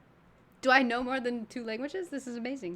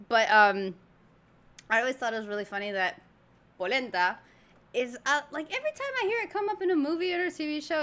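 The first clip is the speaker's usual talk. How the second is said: normal talking voice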